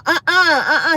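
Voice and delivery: high, loud refusing uh-uhs; mock protest. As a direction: re-enact loud, high-pitched protesting noises. Retold protest in dentist's chair.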